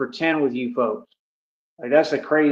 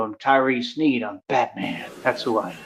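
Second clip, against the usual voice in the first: Batman; 4 semitones lower, 5 dB quieter than usual. Acted. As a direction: play a low-key, self-declared superhero. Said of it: "Why are you talking like that".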